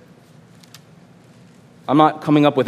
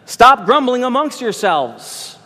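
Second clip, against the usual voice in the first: Jesus rebuking; 8 semitones higher, slower than usual. Acted. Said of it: "Jesus answered and said to them".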